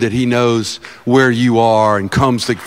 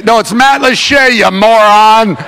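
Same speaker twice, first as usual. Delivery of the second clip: loud, high exasperated correction; mock hollering; 7 semitones higher, 8 dB louder than usual. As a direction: holler the correction, dragging out the insult. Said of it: "when I holler at her".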